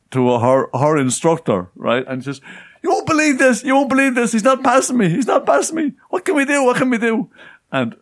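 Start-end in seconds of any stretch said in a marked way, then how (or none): 2.8-7.3 high, distraught, repeating every line; panicked pleading. Retold or imitated. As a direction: mimic a frantic friend begging for help. Friend panicking to her instructor.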